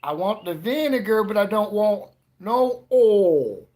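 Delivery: loud, high hammed-up Southern drawl. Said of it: "but their Southern accent. They're like".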